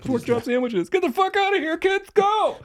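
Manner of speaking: very high, loud cartoon yell; mock PSA shout. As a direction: scream it like a silly PSA cartoon. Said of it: "gonna do a P S A".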